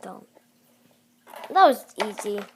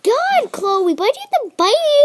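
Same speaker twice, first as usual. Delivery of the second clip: high, loud, fast scolding; pained yelp; 8 semitones higher, 6 dB louder than usual. Real, not acted. Scolding Chloe the cat for biting, high yelping complaint. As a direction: cry out in protest at a sudden bite. Cat just bit the speaker.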